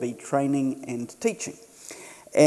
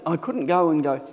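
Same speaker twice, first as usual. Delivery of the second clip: normal talking voice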